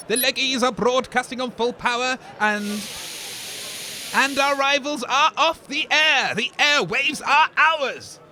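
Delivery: slow, loud, high villain gloat; slow, loud triumphant gloat. Acted. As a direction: savour every word of victory.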